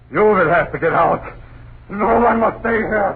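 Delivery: rising anger. Acted. Caretaker insists the family must leave, rising anger. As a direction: warn them they must leave.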